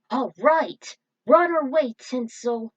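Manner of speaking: high, loud, eager shout; eager call. Acted. Hero calling to Tinsel as they head in, eager. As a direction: call out ready to charge.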